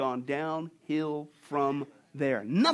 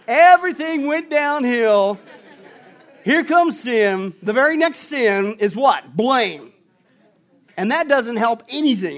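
loud, slow, higher preaching; 4 semitones higher, 9 dB louder, slower than usual. Real, not acted. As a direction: thunder it from the pulpit. Preaching at full force.

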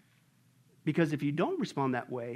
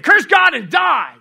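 high, slow, very loud shout; Job's wife yelling at him in a high shout; wife's shout; 12 semitones higher, 17 dB louder, slower than usual. Retold or imitated. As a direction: bellow it like a bitter wife. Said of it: "And she actually said".

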